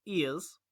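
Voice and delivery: "ears" drawn out as the Queen's yes; posh Queen yes. Shown as a demonstration. Showing her friend the Queen's posh yes: "ears". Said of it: "instead of saying, you say".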